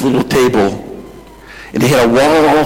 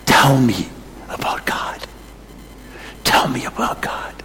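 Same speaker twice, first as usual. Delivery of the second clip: whispered, reverent begging; hushed plea; 8 dB quieter than usual. Retold or imitated. Old man's hushed plea to missionaries.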